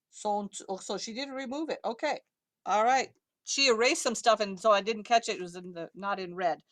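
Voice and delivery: ordinary talk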